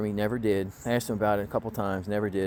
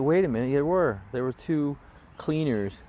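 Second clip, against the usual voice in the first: sarcastic air quotes; 6 semitones higher, 3 dB louder than usual. Retold or imitated. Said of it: "I did the whole quote-unquote thing".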